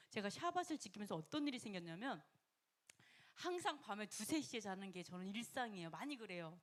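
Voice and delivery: ordinary talk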